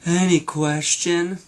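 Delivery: slow, drowsy retake of the question. Shown as a demonstration. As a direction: let it drag like you're half asleep. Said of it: "That's more sleepy".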